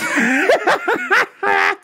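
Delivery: high laugh. Real, not acted. Host laughing at his own outburst, a high burst of laughter.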